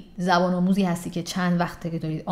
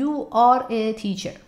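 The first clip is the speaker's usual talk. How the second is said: slow, word by word; 3 semitones higher, much slower than usual. Retold or imitated. Imitating a learner still speaking slowly. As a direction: still slow after years.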